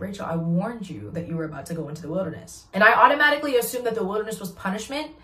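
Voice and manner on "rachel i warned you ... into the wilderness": voice of God. Retold or imitated. God's voice quoted.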